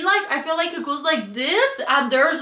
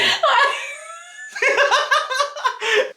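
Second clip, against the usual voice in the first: high laughing fit; 12 semitones higher, 3 dB louder than usual. Real, not acted.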